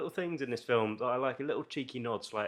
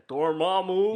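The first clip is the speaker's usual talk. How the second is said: Benedict Cumberbatch voice; 7 semitones higher, 7 dB louder, slower than usual. Retold or imitated. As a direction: say Dormammu like Cumberbatch, rolling and theatrical. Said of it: "you have to say it like Benedict Cumberbatch".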